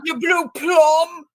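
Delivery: high, loud, drawn-out mock Scouse insult; Carl. Mimicking the man who insulted Ziggy, mock-furious Scouse shout. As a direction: stretch out an angry Liverpool impression. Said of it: "me impersonating Carl, who's from Liverpool".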